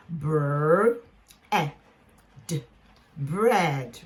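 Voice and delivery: "br", "e", "d" apart, then blended "bread"; sounded out. Shown as a demonstration. Teacher blending a short-e word for learners, sounded out. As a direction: segment "bread" sound by sound with pauses, then join it. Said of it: "so we go".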